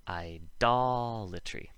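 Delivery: "idolatry" with "DOL" held long; slow, exaggerated stress on DOL in "idolatry"; stressed hard D. Shown as a demonstration. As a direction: stretch the second syllable of "idolatry" on a hard D. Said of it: "would have the hard D".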